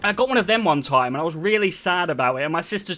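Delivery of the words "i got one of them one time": ordinary talk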